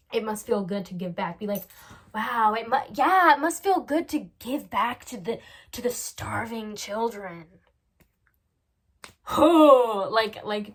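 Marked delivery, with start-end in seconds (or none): 2.1-7.5 loud sneering praise, slowing at the end; mocking admiration. Shown as a demonstration. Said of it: "totally, like, make fun of them". 9.3-10.0 loud exaggerated exhale; scornful huff. Mocks a do-gooder's job.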